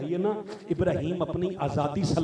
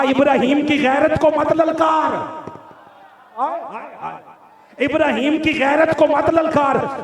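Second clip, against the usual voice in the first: loud, high-pitched sermon storytelling at full force; impassioned preaching; 8 semitones higher, 13 dB louder than usual. Acted. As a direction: thunder out the proud refusal to the crowd. (जोशीली, ऊँची आवाज़ में तक़रीर)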